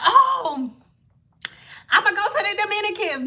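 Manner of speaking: high, fast mock voice; breezy, like it solves everything; mock eager woman. Vlogger imitating women who switched to Dominican salons, eager.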